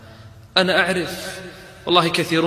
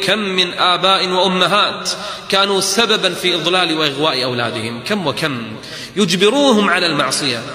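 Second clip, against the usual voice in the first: ordinary talk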